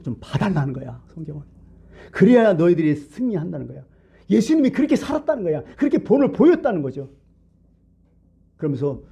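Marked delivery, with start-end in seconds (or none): 2.1-7.1 high, emphatic sermon climax; impassioned preaching. Real, not acted. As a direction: lift the pitch and press the lesson home. Preaches at raised pitch. (열띤 설교조, 높은 음성으로 강조)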